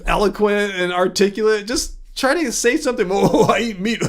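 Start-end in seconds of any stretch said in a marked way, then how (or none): 3.1-4.0 laughing through a mocking caveman voice; mock a dim guy bragging, giggling; lightly hammed-up meat eater, laughing; dumb meat eater voice. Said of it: "I was doing my dumb meat eater voice".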